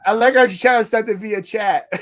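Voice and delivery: ordinary talk, nothing marked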